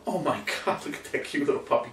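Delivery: soft, fast gushing half-whisper; breathy puppy gushing. Retold or imitated. Owner gushing at a cute puppy, soft and fast. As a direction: melt over the puppy. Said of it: "what do we do?".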